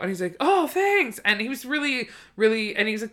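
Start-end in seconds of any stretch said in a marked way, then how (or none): 0.4-1.2 high-pitched, delighted imitation of thanks; grateful surprise. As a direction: act out pleased surprise, pitched way up. Retelling a grateful man's reply.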